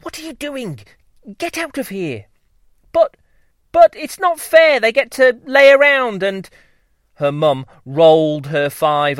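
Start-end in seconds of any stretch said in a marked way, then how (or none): 0.0-2.3 high, hushed scolding mum; urgent whisper. Acted. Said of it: "whiz-buzzed in her ear". 2.9-6.5 high, loud protesting whine; whiny child bee. Acted.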